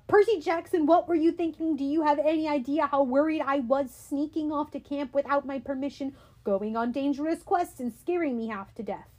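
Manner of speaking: mother scolding her son on the phone for running off. Acted. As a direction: pour out worry as a telling-off.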